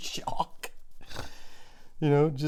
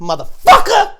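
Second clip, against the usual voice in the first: very loud, much higher yell; war cry; 15 dB louder than usual. Acted. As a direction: bellow it as you charge. War cry attacking Logan Paul.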